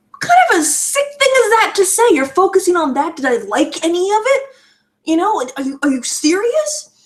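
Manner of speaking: very high, appalled retort; incredulous outrage. Retold or imitated. Teenager reacting to an idiot's sick question, incredulous outrage. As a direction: throw it back in disbelief, pitch soaring.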